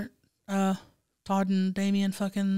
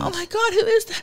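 high, astonished falsetto; flustered high put-on voice; Greg. Character asking who is there, high and amazed.

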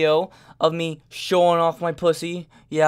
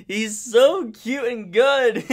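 slow, higher and louder gushing; 8 semitones higher, 6 dB louder, much slower than usual. Real, not acted. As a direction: melt over how cute he is. Gushing about the cat.

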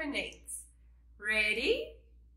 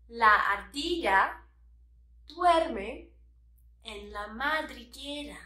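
phrase by phrase. Shown as a demonstration. Teacher modelling the whole sentence, "La ardilla duerme en la madriguera" in chunks. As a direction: pause after "La ardilla" and after "duerme". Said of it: "Now the whole sentence".

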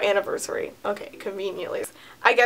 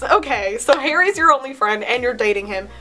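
ordinary talk

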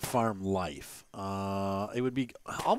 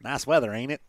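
quick, casual Southern country accent; 3 dB louder than usual. Shown as a demonstration. Player showing the wished-for reading, country accent. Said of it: "do the country accent instead of".

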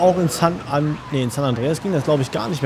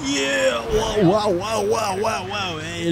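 excited cheering; 8 semitones higher, much slower than usual. Real, not acted. Excited at stunt jump.

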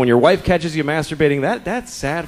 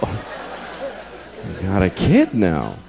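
resigned mutter; 9 semitones lower, 4 dB quieter, much slower than usual. Real, not acted. Resigned trapped father.

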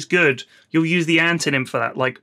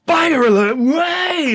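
excited shout; 11 semitones higher, 3 dB louder, slower than usual. Retold or imitated. Mock hype exclamation.

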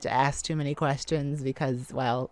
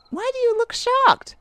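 high, loud startled why; surprised question; 6 dB louder than usual. Acted. Surprised partner asking why he looks shocked, high. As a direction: jump up in pitch at his face.

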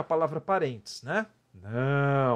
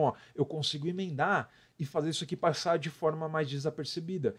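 normal talking voice

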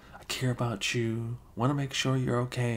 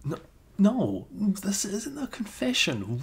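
flustered denial; 10 semitones higher, 4 dB louder, slower than usual. Acted. Flustered denial after awkward slip.

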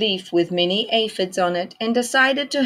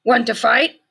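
grouchy ladybug voice; 6 semitones higher, 6 dB louder than usual. A narrator's reading. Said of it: "said the grouchy ladybug".

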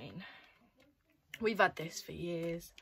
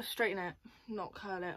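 ordinary talk

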